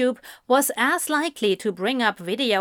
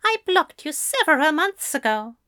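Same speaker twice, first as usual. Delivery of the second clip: high-pitched comeback; Fell retorting; 7 semitones higher than usual. Acted. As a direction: retort primly in a higher voice. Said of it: "Fell retorted".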